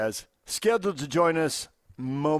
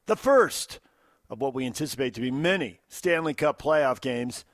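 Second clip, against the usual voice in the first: loud, high, punchy giveaway read; hyped radio promo; 6 semitones higher, 6 dB louder, slower than usual. Acted. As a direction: sell the contest to listeners.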